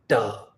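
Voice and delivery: "ta" with a retroflex t, tongue tip curled back; curled tongue heavy consonants. Shown as a demonstration. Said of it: "retroflex sounds like".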